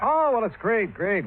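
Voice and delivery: high, airy mock cheerfulness; teasing the boss with cheerful small talk; breezy evasion. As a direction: breezily dodge the question. Dodges boss's pointed question.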